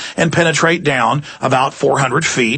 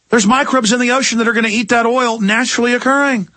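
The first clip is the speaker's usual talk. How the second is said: normal talking voice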